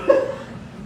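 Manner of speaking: quick airy chuckle; short breathy laugh. Real, not acted. Laugh after his own line.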